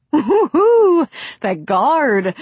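high, loud excited whoop; gasped. A narrator's reading. Young woman gasping to companions on the funicular, excited. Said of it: "she gasped".

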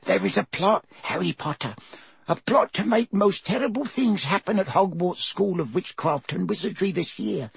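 elf whisper. A narrator's reading. Dobby warns Harry of a plot, hushed elf voice. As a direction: confide a dread secret. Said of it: "whispered Dobby".

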